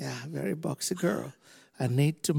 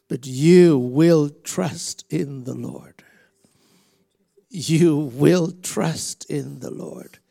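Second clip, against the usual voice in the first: slow, forceful prophetic declaration; preaching declaration; 9 dB louder, slower than usual. Real, not acted. Preacher declaring over a woman, slow and strong. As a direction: declare it slowly, with weight.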